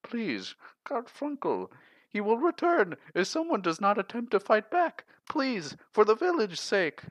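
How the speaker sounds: high, desperate pleading; pleading farmer. Acted. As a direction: beg with rising despair. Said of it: "the farmer pleaded once more".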